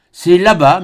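ordinary delivery

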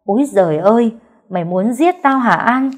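a mother-in-law yelling for her daughter-in-law after slipping; screaming. Acted. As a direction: shriek and curse after a fall. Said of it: "mẹ chồng tôi bên ngoài la hét".

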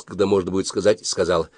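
usual speaking voice

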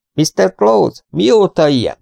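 high, projected question. A narrator's reading. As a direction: ask it high for someone hard of hearing. Asking deaf old man. (emelt, magas hangon)

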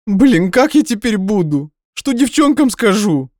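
high, slow, loud despairing voice; whining lament. Acted. Nadya despairing to Dima, high slow lament. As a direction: wail it out.

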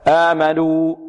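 آمنوا with the final waw drifting toward an a sound; sung-out wrong waw; waw tinged with alif. Shown as a demonstration. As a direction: let آمنوا end half like alif. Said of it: "خطأ".

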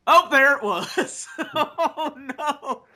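high-pitched cry, loud at first, dissolving into laughter; startled laughing outcry. Real, not acted. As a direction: react to a botch as it happens. Reacting live to a wrestling botch.

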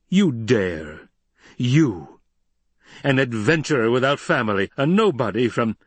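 indignant, sputtering disdain; outraged. A narrator's reading. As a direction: scorn an upstart in outrage. Emperor outraged at Paul's proposal.

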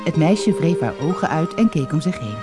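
ordinary delivery

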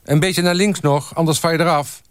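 high-pitched shouted instructions; calling out. A narrator's reading. Photographer directing staff on the doorstep, calling out. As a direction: call directions across the street. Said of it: "riep Bosman".

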